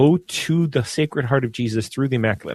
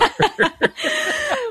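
very high, squealing laugh. Real, not acted. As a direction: burst of real high laughter. Laughing at his accent.

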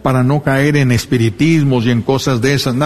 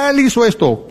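mock accusing enemy; 9 semitones higher than usual.

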